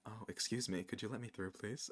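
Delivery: mock demure, barely audible; demure and soft. Shown as a demonstration. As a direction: shrink into a tiny polite voice. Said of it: "in a demure way".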